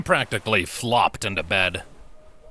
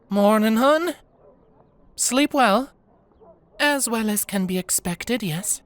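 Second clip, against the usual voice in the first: put-on high, friendly young woman's voice; friendly smile; 12 semitones higher than usual. Tukey greets Diana at breakfast, friendly and smiling. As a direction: greet her host with a smiling lilt. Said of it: "Tukey said with a smile and a warm nod".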